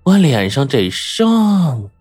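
slow, menacing, drawn-out question; deliberate, threatening interrogation; word by word. A narrator's reading. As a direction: space out every word and stretch the last. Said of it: "他一字一顿".

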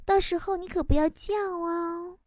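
slow, soft smiling threat; smiling reply. Acted. Nurse answering the cheeky patient, slow sweet menace. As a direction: answer sweetly, drawing the words out. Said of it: "瞬间微笑着说".

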